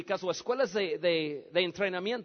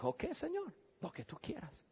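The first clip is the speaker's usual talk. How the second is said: very soft, dropping away; quietly surrender, almost under the breath; humble submission; 15 dB quieter than usual. Retold or imitated. Humble surrender to God in prayer.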